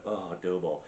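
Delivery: low, soft, savouring aside; mock tender murmur. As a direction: murmur fondly to the dough, playacting. Acts out fondling dough.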